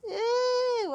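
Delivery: high, loud squeal of disgust. Real, not acted. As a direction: recoil from the bugs. Woodlice nearby.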